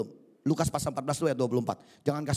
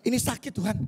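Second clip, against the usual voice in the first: high, pleading whine of excuses; lightly whining believer making excuses to God; whiny excuse; 7 semitones higher than usual. Retold or imitated. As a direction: mimic a believer begging off. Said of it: "kita udah bilang".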